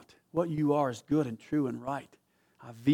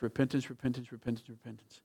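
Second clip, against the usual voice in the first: each repentance softer until it dies away; fading repetition; 3 semitones lower, 8 dB quieter than usual. Acted. Preacher repeating repentance, fading to a hush. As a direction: let the word fade out.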